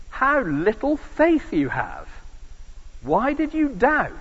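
Jesus scolding sinking Peter, hammed-up reproach; mock rebuke. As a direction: tell him off like a disappointed master. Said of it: "Jesus gives him a rocket".

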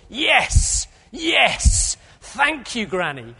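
put-on high, loud cries of a delighted boy; imitated excited shout. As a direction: shout it like a seven-year-old thrilled with his present. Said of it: "he shouted at the top of his voice".

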